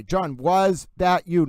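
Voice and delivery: "John, was that you" slow, word by word; drawn-out intonation. Shown as a demonstration. Host correcting the quoted phrase, slow and emphatic. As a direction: lean on a long WAS. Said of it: "get through the intonation".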